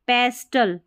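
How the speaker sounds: पेस्टल with the T sounded; wrong pronunciation. Shown as a demonstration. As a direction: say पेस्टल with a clear T after S. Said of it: "फिर इसको पेस्टल नहीं".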